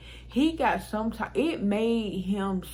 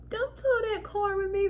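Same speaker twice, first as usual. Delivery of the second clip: high-pitched mimicking voice; exaggerated high imitation of an ex; mocking him; 12 semitones higher than usual. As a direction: parrot it back in a high mocking voice. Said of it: "Why this dude talking about".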